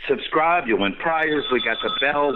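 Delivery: high, bright mock promo call; excited pitch. Real, not acted. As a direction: hype up the subscribers like an announcer.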